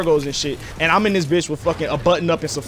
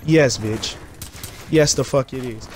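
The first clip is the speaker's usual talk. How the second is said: much lower, smug confirmation; cocky flex; 5 semitones lower than usual. Retold or imitated. Kid bragging to girls about his DS, lower smug cocky voice. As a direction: drop the voice and brag. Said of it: "And I was just like".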